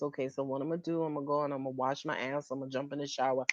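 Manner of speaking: low, soft, very fast plan; low, fast general laying down orders; rushed orders. Retold or imitated. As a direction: rattle off the plan quickly. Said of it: "He lays down orders".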